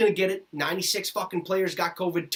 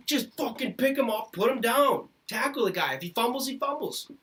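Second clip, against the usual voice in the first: higher, heated fed-up ranting; exasperated yelling; 7 semitones higher than usual. Real, not acted. Fed-up fan yelling tackling advice at his team, exasperated. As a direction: shout instructions at the team in exasperation.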